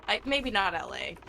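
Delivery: ordinary talk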